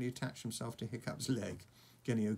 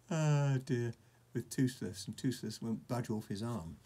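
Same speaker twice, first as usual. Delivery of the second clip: ordinary delivery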